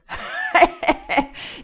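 loud, high jeering laugh. Acted. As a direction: burst out laughing loudly. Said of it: "am lautesten Luke".

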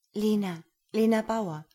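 put-on nervous young woman. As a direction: give your name shyly, a little breathless.

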